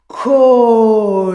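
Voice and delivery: "call" held out loud; long loud call. Shown as a demonstration. Teacher demonstrating the word "call" loudly. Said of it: "The L goes up".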